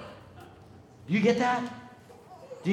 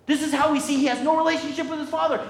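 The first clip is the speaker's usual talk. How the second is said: normal talking voice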